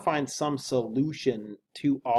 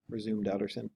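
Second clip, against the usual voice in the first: ordinary delivery, nothing marked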